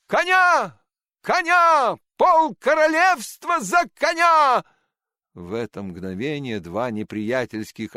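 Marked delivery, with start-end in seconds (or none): 0.1-2.0 high, loud cry of despair; desperate shout. A narrator's reading. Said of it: "В полном отчаянии Щелкунчик закричал". 2.2-4.6 high, loud, slow desperate cry; desperate shout. A narrator's reading. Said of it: "В полном отчаянии Щелкунчик закричал".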